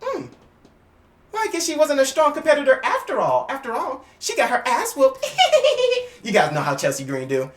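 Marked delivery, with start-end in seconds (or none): 0.0-5.2 high, smug gloat; imitating a smug gloating wrestler. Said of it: "gonna be like". 5.3-6.2 high laugh. Real, not acted. Laughs at own impression.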